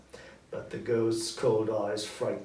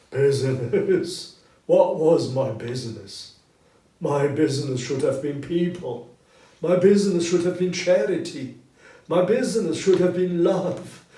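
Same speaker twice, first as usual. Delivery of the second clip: impassioned ghost; 5 semitones higher, 11 dB louder than usual. Acted. Marley's ghost rebuking Scrooge, loud anguished lament. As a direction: cry out your regret.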